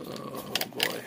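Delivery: normal talking voice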